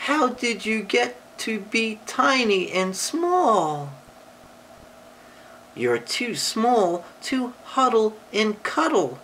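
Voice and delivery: high bawling bear voice, a bit teary; bawling giant bear. A narrator's reading. Giant bear bawling at the little boy. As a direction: wail it like a big sad bear. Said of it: "the voice bawl".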